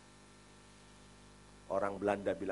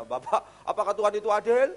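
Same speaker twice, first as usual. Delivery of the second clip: louder, higher pressing question; the man asking again, higher and louder; 10 semitones higher, 8 dB louder than usual. Retold or imitated. Repeating the man's question.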